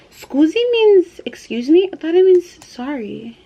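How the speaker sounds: startled realization, stretching it out; wait, really?; surprised. Real, not acted. Surprised at the word's meaning.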